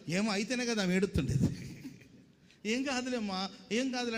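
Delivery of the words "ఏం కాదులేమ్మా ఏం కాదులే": higher, sing-song empty reassurance; mock soothing. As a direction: parody a hollow 'it's nothing, dear'. Mocking an empty comforting phrase.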